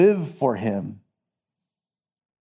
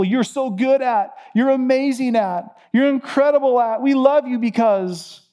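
much higher, sugary over-the-top praise; gushing flatterer; 8 semitones higher than usual. Retold or imitated. Preacher mocks people elevating someone, gushing high. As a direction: lay the flattery on thick and high.

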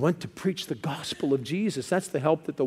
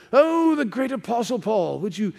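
higher-pitched, hammed-up English accent; goofy English accent; 6 semitones higher, 3 dB louder than usual. Preacher imagining the Macedonian man begging Paul, goofy English accent. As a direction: put on a terrible, theatrical English accent. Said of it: "goofy, terrible English accent".

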